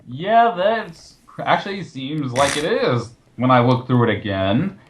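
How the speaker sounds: ordinary delivery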